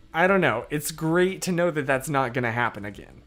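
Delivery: usual speaking voice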